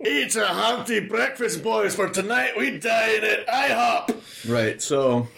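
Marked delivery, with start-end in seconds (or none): 0.1-4.2 high, slow mock Scottish shout with a laugh; hammed-up Scottish war cry; Spartan general. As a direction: bellow it like a Spartan king, laughing. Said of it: "Spartan General Gerard Butler impersonation".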